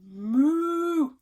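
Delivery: loud, high mooing; cow moo. Acted. Parent to child, a loud playful cow moo. Parent reading animal sounds.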